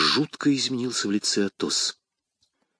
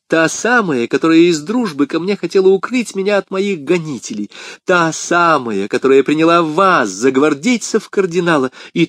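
higher, louder, emphatic on each repeat; eager explanation; 6 semitones higher, 4 dB louder than usual. Acted. Woman explains her friend to the men, eagerly. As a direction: insist excitedly, stretching the key phrases.

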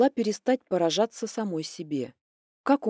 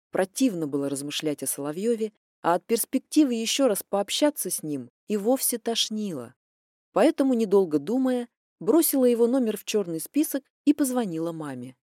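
usual speaking voice

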